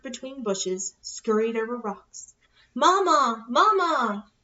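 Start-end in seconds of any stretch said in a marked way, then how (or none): 2.7-4.3 high, loud repeated calls; calling out. Acted. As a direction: shout for Mama across the woods. Searching, calling her mother.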